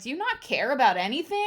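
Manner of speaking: mock outburst at an indifferent partner; frustrated. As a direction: replay of an indignant complaint. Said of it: "get really frustrated".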